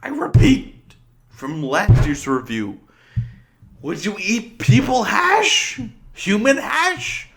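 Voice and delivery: loud incredulous rant. Real, not acted. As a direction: rant in mounting disbelief. Ranting loudly at the movie.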